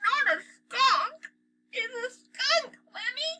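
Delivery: very high, slow stuffed-up voice; family member blocked-nose from the smell; held-nose voice. A narrator's reading. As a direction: speak like you have the worst cold. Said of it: "sounded as if they had the worst colds".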